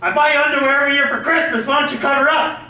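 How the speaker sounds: high, fast nagging wife; scolding wife. Retold or imitated. Wife scolding Harold about his underwear, high fast nagging. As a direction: nag him in a high voice, quickly. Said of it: "Wife would always say".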